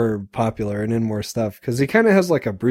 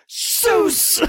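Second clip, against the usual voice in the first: very high yelp of Seuss; high cartoon kung fu yelp; weird kick yelp. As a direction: squeal it like a goofy fight cry. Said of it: "the frame and goes".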